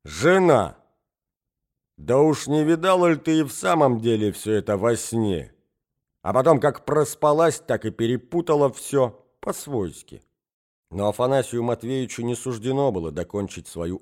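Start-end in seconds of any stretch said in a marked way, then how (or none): 0.0-10.2 self-important. A narrator's reading. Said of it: "с важностью".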